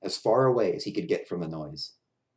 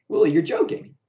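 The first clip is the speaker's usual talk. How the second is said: high, louder amused friend; friend's voice; 7 semitones higher, 7 dB louder than usual. A narrator's reading. Clown friend teases Willie, amused and high. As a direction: tease him gently, amused. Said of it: "laughed the other clown".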